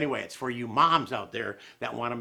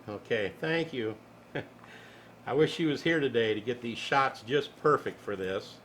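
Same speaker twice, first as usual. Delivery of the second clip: ordinary delivery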